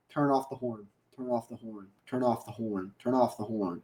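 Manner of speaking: low, soft repeated chant; hushed, low-pitched urging at the screen; insistent muttering. Retold or imitated. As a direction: mutter it under your breath over and over.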